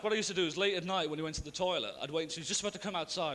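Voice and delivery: usual speaking voice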